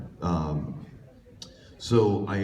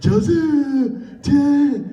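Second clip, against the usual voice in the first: very high, loud squeal breaking into giggles; imitated giggly squeal; 9 dB louder, much slower than usual. Giggling kid retrying his audition, high mock squeal. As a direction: squeal the names, then crack up, imitating him. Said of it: "And he's laughing".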